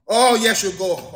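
ordinary delivery

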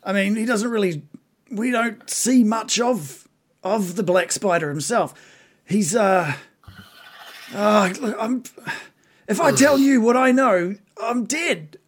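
high, hesitant creature voice; reluctant bugbear. Acted. Captive bugbear fearing death if he talks, reluctant.

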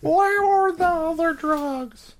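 stretched squeaky silly voice; silly high voice. Pretend. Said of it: "best voice ever".

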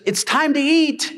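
high sing-song call to eat; mock mom announcing dinner. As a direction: call the family to the table like mom. Mom calling family to dinner.

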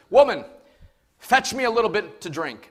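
loud, high barked order; commanding prophet. Retold or imitated. Prophet ordering the widow, loud and blunt. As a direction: demand it bluntly, no please. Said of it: "but he says to her".

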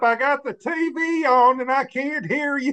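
high-pitched put-on complaint; imitated hard-of-hearing woman. Woman with the TV on, imitated high complaint. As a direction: raise your voice high over the TV.

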